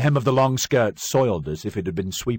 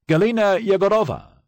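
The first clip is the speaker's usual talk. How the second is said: loud, high call across the courtroom; calling out a name; 13 semitones higher, 8 dB louder than usual. Acted. Prosecutor calling the accused to stand, loud and high. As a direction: bellow the name to summon her. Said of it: "he called".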